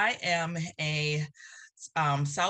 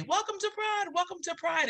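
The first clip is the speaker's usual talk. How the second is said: imitating an MC's welcome, pitched much higher; emcee welcome; 14 semitones higher than usual. Guest imitates the Pride emcee greeting the crowd, pitched much higher. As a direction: sing out the welcome like the host on the festival mic. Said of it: "this voice say".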